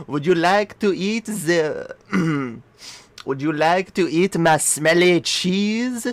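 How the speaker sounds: lightly put-on French accent, barely there; fake French accent. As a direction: tease in a mild mock French accent. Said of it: "a normal French accent".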